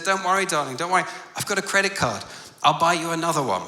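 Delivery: high, loud, flustered reassurance; panicked dad. Retold or imitated. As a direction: imitate a father frantically soothing his child. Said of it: "and he just panicked".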